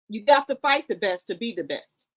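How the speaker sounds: normal talking voice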